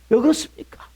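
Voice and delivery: normal talking voice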